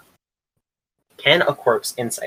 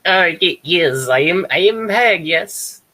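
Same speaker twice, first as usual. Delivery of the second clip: hag voice; 7 semitones higher, slower than usual. Acted. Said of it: "She's gonna go like".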